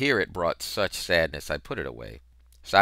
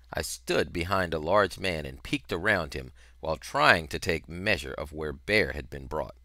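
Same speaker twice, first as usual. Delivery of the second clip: ordinary delivery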